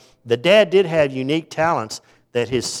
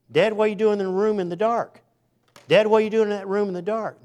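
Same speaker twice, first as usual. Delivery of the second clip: a higher, lightly voiced kid asking; curious child; 8 semitones higher than usual. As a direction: gently play the puzzled child. Children surprise dad praying.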